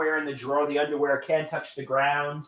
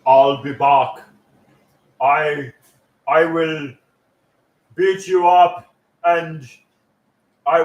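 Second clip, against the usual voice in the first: Arnold impression; 3 semitones lower, 7 dB louder, slower than usual. Terminator line.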